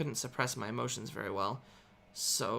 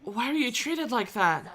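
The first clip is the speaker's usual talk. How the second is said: higher, concerned questioning; worried brother; 11 semitones higher, 7 dB louder, slower than usual. A narrator's reading. Brother questioning his crying sister. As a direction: ask gently what happened.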